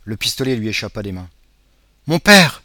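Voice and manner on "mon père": high, loud cry of joy; breathless girl crying out in relief; joyful cry. Acted. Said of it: "s'écria la jeune fille". (cri de joie aigu et fort)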